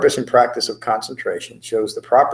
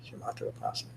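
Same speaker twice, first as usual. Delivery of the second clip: whispered try; 13 semitones lower, 20 dB quieter, faster than usual. Real, not acted. Trying the word under his breath.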